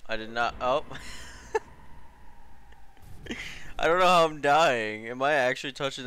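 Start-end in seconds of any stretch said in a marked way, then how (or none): 0.9-3.7 airy high-pitched giggle; wheezy laugh at own failure; high breathy laugh. Real, not acted. Dies in game, bursts out. 3.8-5.1 loud, exasperated complaint; frustrated outburst. Real, not acted. Frustrated after dying again.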